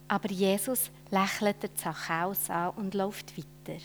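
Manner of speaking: ordinary delivery, nothing marked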